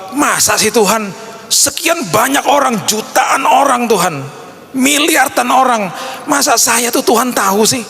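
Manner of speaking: high-pitched, drawn-out disbelief; incredulous doubter. Acted. Believer among billions doubting God knows them, acted high incredulity. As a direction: act out doubting that God could notice you. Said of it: "kita mungkin berpikir bahwa".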